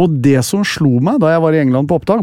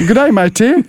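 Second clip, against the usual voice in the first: British accent; 8 semitones higher than usual. Shown as a demonstration. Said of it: "men ikke sånn som jeg sa det nå".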